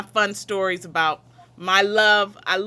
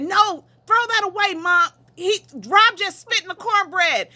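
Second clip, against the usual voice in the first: high-pitched, urgent plea, much higher; retold urgent yell; 8 semitones higher than usual. Daughter begging mom to throw out the cornbread, high and urgent. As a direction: beg her mother to toss it, higher and higher. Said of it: "And I was yelling".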